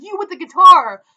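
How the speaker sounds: loud, high-pitched holler; single someone out with a retold yell; imitated yell. Homeless man yelling at the guitarist, imitated yell. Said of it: "homeless guy is yelling at me".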